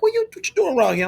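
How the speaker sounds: the uncle quizzing him on the street; nosy uncle. Retold or imitated. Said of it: "He was like".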